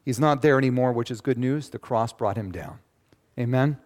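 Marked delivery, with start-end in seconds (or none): none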